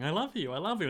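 soft, lightly acted panic; mock panicked pleading; panicking. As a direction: plead quietly like a cornered pet. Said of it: "it's panicking and going".

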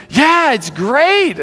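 high, drawn-out mock enthusiasm; hammed-up slow, high reassurance; emphatic enthusiasm. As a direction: stretch it out high, imitating the wife. Wife insists all is great.

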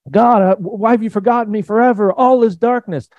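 high, agitated mock lament; hammed-up anguished cry, pitched well up; despairing psalmist. A psalmist crying out to God in darkness, mock despair.

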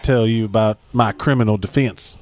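genteel Southern lawyer, r's dropped; lofty Charleston accent. Acted. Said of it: "It's a very lofty voice".

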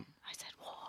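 hushed whisper; barely voiced whisper. Real, not acted. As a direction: whisper it under your breath. Muttered answer to the quiz.

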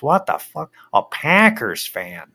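hammed-up shock at a Packers fan; mock outrage. Bears fan learning wife roots for Packers, loud mock outrage.

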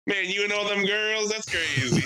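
lightly hammed-up teasing; corny joker. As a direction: play up the cheesy punchline. Delivering retold corny dad joke.

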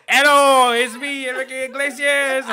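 high, loud, hammed-up Latin-star voice with a laugh. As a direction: ham up a cheesy pop star introduction. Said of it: "most, like, cringiest, like, just imitation of [name]".

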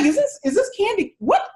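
high, incredulous mock confusion; retold bewilderment. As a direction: act out puzzled disbelief. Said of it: "I'm like".

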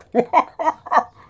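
very loud, high cackling laugh; hammed-up shrieking laughter; high-pitched mock laugh. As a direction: laugh in the squeaky put-on voice. Mocking his own high-pitched slip.

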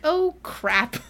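high, loud sudden realisation; playful oh-no moment; mock dismay. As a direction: imitate dawning panic, half-laughing. Scarlet realising she meets mother-in-law.

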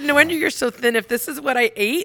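high, loud, teasing disbelief; loud mock outrage. To her friend, teasing about the kale salad: high mock outrage. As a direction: ham up the horror at her diet. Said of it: "So I look at her and I said".

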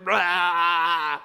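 long, loud imitated roar; mock roar. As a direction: roar like someone lashing out. Imitates person roaring at him.

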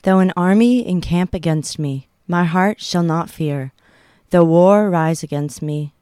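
normal talking voice

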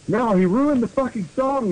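high-pitched yell without much volume; hammed-up read-aloud yell; mock yell. A narrator's reading. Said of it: "yelled Samara".

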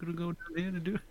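high, soft, quick landlord impression; imitated landlord. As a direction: mumble it fast in the landlord's high voice. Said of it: "that talked just like that".

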